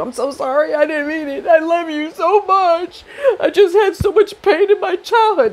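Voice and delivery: high, whiny fake sobbing plea; mock crying apology. Partner fake-crying to win her back, hammed-up sob. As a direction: ham up a tearful manipulative sorry, laughing through it. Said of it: "If he has to drop a tear or two".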